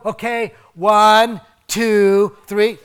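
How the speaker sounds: hammed-up one-two-three countdown; mock parent countdown. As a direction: bark out the count like an exasperated parent.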